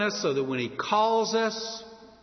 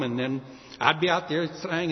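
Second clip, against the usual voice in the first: ordinary talk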